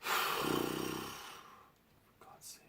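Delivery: sighing whisper of despair; exasperated whisper. Real, not acted. Fed up with the villain, a whispered sigh. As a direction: breathe it out hopelessly.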